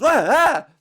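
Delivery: a mock startled driver's yelp. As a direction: yelp like someone just farted in your ear. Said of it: "and the guy goes".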